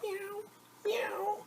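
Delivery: high squeaky kitten meow; cat meow. Acted. Storyteller voices the cat, high meowing. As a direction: mew like a tiny cat.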